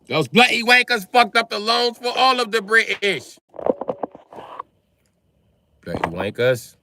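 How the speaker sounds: high, loud mock British rant. Mocking British people, a loud high British accent. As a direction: spit it out like an outraged Brit.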